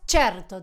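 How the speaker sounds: low, firm "Certo" as CHER-to; Italian reply. Acted. Italian confirming a doctor's appointment, low firm "Certo". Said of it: "te dicen".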